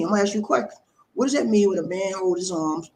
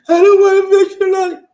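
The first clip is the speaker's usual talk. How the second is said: much higher and louder, a mock screeching wife; mock shrill wife; 14 semitones higher, 12 dB louder than usual. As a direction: squawk back like an annoyed wife. Wife refusing husband's order.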